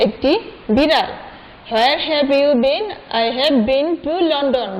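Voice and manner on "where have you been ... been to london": put-on very slow, word by word for children; slow read-aloud for a class; slow teaching read. Shown as a demonstration. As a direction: spell it out slowly so kids can follow. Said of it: "আমি মুখে উচ্চারণ করে তোমাদের পড়িয়ে দিচ্ছি".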